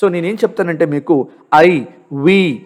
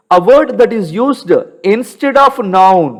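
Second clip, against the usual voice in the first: slow dictation; 2 semitones higher, much slower than usual. Shown as a demonstration. Dictating a definition to students.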